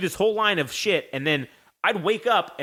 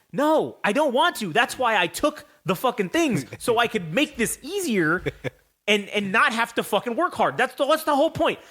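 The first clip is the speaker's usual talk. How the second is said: protesting; 6 semitones higher than usual. Retold or imitated. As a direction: whine back in your own defense. Retold protest to Triple H.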